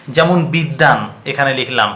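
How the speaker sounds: usual speaking voice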